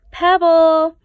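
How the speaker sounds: high, loud call of the name. Acted. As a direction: grab the penguin's attention. Photographer calling out.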